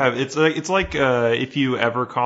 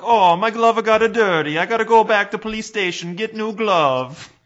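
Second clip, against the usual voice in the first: high, loud put-on Italian accent; Italian cop fussing over a dirty glove; mock Italian cop; 11 semitones higher, 4 dB louder than usual. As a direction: fuss like a prissy Italian policeman. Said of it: "and they're like".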